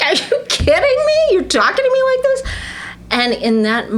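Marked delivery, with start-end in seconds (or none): 0.0-2.4 much higher and louder, stunned; laughing in his face; mock outrage, high and bright; incredulous disbelief. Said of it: "I literally started laughing".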